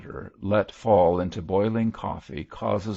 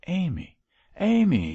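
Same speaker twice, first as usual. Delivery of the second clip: high, appalled exclamation; shocked reproof; 12 semitones higher, 5 dB louder than usual. A narrator's reading. Bishop checking his wife's wild words, shocked. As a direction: call the name in rising shock.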